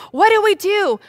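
high, loud panicked cry; frantic, loud and high; acted panic. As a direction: cry out in hammed-up desperation. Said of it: "they're also really scared".

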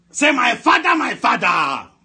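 high opening cry falling away; shouted prayer call. Real, not acted. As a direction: call the congregation to repeat, at a shout. Leading congregation in prayer call.

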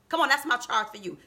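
ordinary talk

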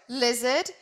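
ordinary delivery, nothing marked

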